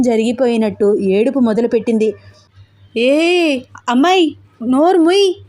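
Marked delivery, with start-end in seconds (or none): 3.0-5.3 high-pitched, flustered pleading; narrated panic, voice jumping high. A narrator's reading. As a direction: panic at someone suddenly crying. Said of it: "తెగ కంగారు పడిపోతూ".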